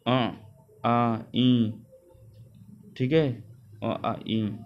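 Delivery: अ, आ, ई nasalised, air through the nose; nasal vowel demo. Shown as a demonstration. Teacher showing students nasalised vowels. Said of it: "नाक से भी निकलती है".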